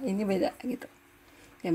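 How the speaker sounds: ordinary talk, nothing marked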